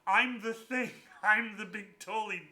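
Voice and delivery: high, loud owning-up with a laugh; retold awkward confession. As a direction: blurt out the admission, half laughing. Acted embarrassed confession to woman.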